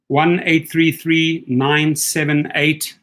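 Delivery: reading digits slowly and evenly; slow each number so they can write it down; careful spoken digits; slow dictation. Shown as a demonstration. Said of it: "Let me just write that down".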